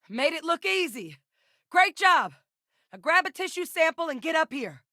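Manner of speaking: high-pitched battlefield holler; projected, high shouted praise and orders; combat call-out. Acted. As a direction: holler it across the battlefield to the squad. Battle call-outs in game.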